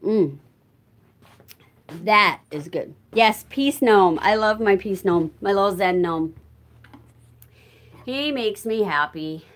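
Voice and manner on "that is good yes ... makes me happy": usual speaking voice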